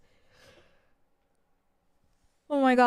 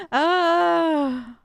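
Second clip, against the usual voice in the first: loud, higher drawn-out groan; exasperated groan; 5 semitones higher, 5 dB louder than usual. Real, not acted. Daughter still mad at mom, exasperated groan. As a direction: let out the frustration in one long sigh. Frustrated groan after retelling.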